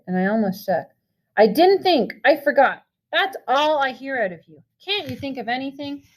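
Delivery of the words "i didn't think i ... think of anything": high, exasperated mocking tone, fading; mock his excuses in disgust; angry mimicry. A narrator's reading. Brother mocking Pony's excuses, exasperated anger. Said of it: "Derry shouted".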